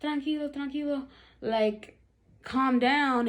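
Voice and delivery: soothing plea. Retold or imitated. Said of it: "I'm like".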